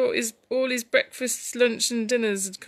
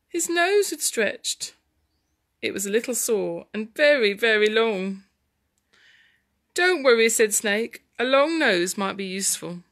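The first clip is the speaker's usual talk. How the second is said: put-on lively story narration for children; dramatic storytelling; 6 dB louder than usual. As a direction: read the tussle with big dramatic swings.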